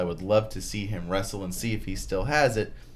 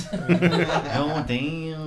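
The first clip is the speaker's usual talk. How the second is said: laughing; 6 semitones higher, 4 dB louder than usual. Real, not acted. Laughing reaction to old wrestler.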